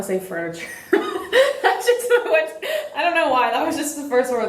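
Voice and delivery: laughing through the words; giggling at her own word. Real, not acted.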